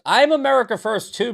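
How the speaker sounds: loud high sarcastic echo of "I'm America first too". Shown as a demonstration. Streamer sarcastically echoes a chat comment, loud and high. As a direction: toss the chat's line back loud and mocking. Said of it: "when I'm being sarcastic".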